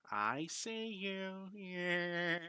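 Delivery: Peter Griffin. Retold or imitated. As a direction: stretch it out like a goofy cartoon dad. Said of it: "a Peter Griffin reference".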